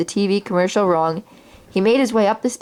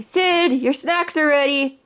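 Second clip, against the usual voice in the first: much higher call from downstairs; mom calling out; 7 semitones higher, slower than usual. Acted. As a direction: call the kid down for snacks. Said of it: "Sid's mom yelling".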